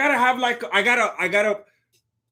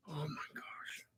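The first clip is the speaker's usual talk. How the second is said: breathy hushed whisper; 6 semitones lower, 24 dB quieter than usual. Real, not acted. Streamer whispering an aside to his chat, hushed whisper. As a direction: drop to a secret whisper, lower.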